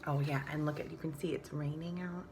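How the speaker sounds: usual speaking voice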